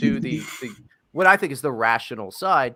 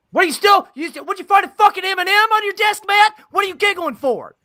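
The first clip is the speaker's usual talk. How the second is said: much higher, loud exasperated shout; incredulous yell; 9 dB louder than usual. Real, not acted. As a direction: shout at him in disbelief. Yelling at giggling Matt.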